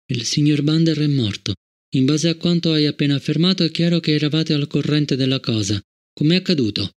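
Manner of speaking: ordinary delivery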